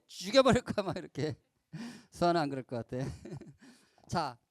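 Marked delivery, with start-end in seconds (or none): 0.1-0.9 loud, raised-pitch mock threat; harsh threat. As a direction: throw out an acted threat in anger. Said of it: "막 험한 말도 하지".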